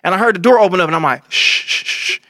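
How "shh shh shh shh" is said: long hissed shushes, one after another; urgent shushing. Retold or imitated. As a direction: hush someone before you get heard. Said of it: "be quiet for real".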